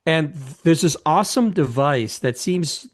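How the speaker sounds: usual speaking voice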